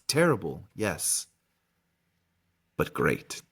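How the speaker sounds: low, lightly voiced ominous old wandmaker; wandmaker character. Wandmaker warning Harry of great things, low and ominous. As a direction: let the phrases sink into grave pauses. Reads Ollivander's closing speech in character.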